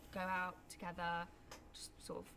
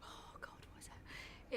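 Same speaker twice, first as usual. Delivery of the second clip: whispering; 8 dB quieter, slower than usual. Real, not acted. Trying to recall a joke.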